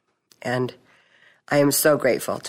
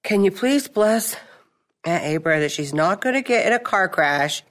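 imitating daughter's prayer; 5 semitones higher than usual. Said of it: "She says".

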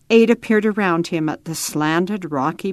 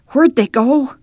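ordinary delivery

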